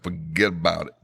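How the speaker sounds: a light New Jersey "forget about it"; light New Jersey accent, tossed off. Shown as a demonstration. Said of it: "my New Jersey accent".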